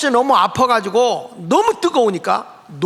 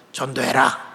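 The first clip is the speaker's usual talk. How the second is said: voice of the Lord; 8 semitones lower than usual. Retold or imitated. The Lord commands the preacher, low and solemn. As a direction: speak as God, low and calm. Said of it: "주의 음성이 다시 들려".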